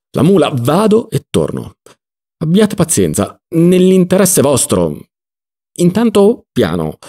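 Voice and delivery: high, drawn-out appeal for patience; flustered placating. Acted. Don Lollò to the prisoner before leaving, flustered placating. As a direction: placate him while hurrying off.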